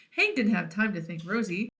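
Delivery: usual speaking voice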